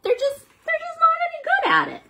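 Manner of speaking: high, fast, hammed-up whimper; mock crying. Reader pretends to cry about the parents, hammed-up. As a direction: fake a sob for laughs.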